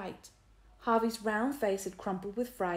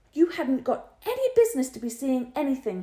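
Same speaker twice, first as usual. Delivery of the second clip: higher, louder cross scolding; crossly; 6 semitones higher, 8 dB louder than usual. A narrator's reading. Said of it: "she said crossly".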